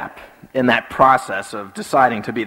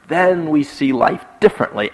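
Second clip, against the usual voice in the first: ordinary talk, nothing marked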